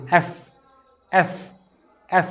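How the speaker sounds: "এফ" with lip under the teeth, air pushed out; letter F demo. Shown as a demonstration. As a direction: breathe out through "এফ". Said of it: "এভাবে মুখ দিয়ে বাতাস বের হবে". (দাঁতে ঠোঁট রেখে "এফ")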